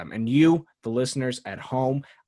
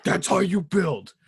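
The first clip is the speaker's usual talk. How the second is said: slow put-on voice, higher than usual; mocking co-host impression; 4 semitones higher than usual. Host mimicking how co-host talks about the team, mocking impression. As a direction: parody the co-host's hype, drawn out. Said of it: "because the way you talk, you're like".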